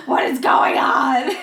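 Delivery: slow, loud, laughing through the words; laughing disbelief. Retold or imitated. Retelling the stoned panic to cohost, laughing loudly. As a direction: crack up while asking. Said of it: "And I was like".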